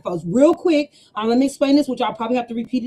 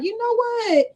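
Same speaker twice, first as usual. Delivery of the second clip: exasperated; 9 semitones higher, slower than usual. Retold or imitated. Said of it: "when I be like".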